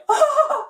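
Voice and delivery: hearty cartoon chuckle; high relaxed laugh; Shaggy general laugh. Shown as a demonstration. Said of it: "general laughter".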